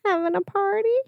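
high, soft odd voice; weird voice. Retold or imitated. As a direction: pitch it up into a softly weird question. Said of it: "like that weird voice".

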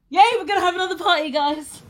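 fast, high, loud cheer; excited shout. Real, not acted. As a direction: burst out cheering with glee. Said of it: "I just shouted it".

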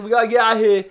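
shouted, high mock-British panic; bad British accent. Roommate rushing out late, loud fake British yell. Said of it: "poorly accented British man".

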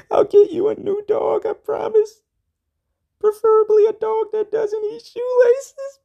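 hammed-up wailing in a very high squeal; sobbing plea. Dumped man begging Marianne to take him back, mock sobbing wail. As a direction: bawl and beg in exaggerated falsetto, loud.

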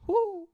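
high loud owl hoot. Shown as a demonstration. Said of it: "What's an owl make?".

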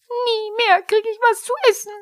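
very high, loud wail; hammed-up howling lament; Pumuckl. A narrator's reading. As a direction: wail it like a bawling little kobold. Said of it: "Pumuckl heulte los".

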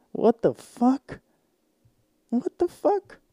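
high, slow, stunned; shocked disbelief. Real, not acted. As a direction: shriek it out in disbelief. Reacting to the queen discount.

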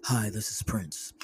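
soft, low phone voice. Retold or imitated. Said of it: "he gets on the phone".

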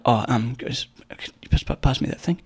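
soft, hesitant, fading to a whisper; shy mumble nobody can hear; timid ask. Retold or imitated. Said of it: "the confidence isn't there".

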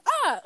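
high, short mock yelp; imitated "ah". Shown as a demonstration. Showing cohost how Carter says it, high imitated yelp. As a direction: pitch the "ah" way up like his. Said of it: "The way he says".

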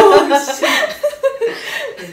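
high, loud laughing swear; laughing slip of a curse. Real, not acted. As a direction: blurt it out mid-laugh. Slips while laughing.

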